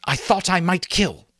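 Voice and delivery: high, a touch of obsession; hint at the urge to kill; mild obsessed hunter. A narrator's reading. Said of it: "madness came into his eyes".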